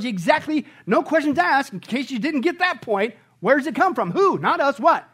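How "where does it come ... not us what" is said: ordinary talk, nothing marked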